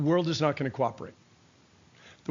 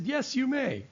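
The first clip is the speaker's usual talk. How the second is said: gentle grandpa; 9 semitones higher than usual. Retold or imitated. Grandpa answers the child.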